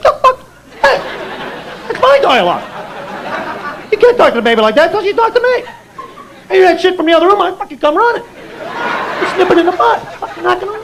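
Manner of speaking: high, indignant talking dog; jealous dog. Acted. Family dog objecting to baby getting his dialogue, jealous and indignant. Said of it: "the dog comes like".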